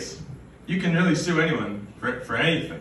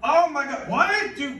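exasperated; 13 semitones higher, 4 dB louder than usual. Real, not acted. Exasperated outburst.

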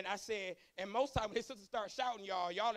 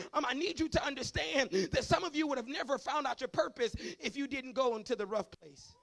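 ordinary talk, nothing marked

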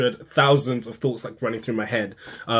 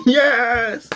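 high, loud yes; cheering back at a toddler; excited cheer; 13 semitones higher, 6 dB louder than usual. Retold or imitated. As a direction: celebrate with the kid.

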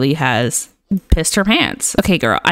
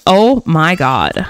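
ordinary talk

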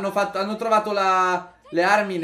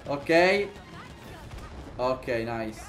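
ordinary delivery

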